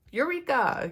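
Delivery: high, loud triumphant exclamation; mock eureka. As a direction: announce it high like a discovery. Exclaiming discovery.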